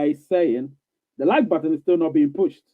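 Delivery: usual speaking voice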